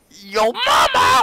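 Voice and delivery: very high, loud drawn-out yell; mock shout. Gamer reacting to a character's name, loud high mock shout. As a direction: belt it out high and long.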